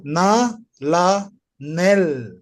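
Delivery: Na, la, nel spelled out; teacher sounding nel; reading demo. Shown as a demonstration. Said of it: "can you repeat the reading again".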